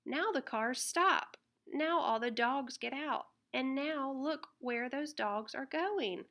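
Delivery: plain reading voice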